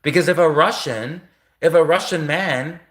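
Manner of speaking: ordinary delivery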